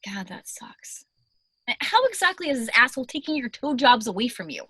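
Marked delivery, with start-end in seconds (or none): 0.0-1.0 soft unvoiced whisper. Real, not acted. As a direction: breathe it out as a whisper. Whispered sympathy.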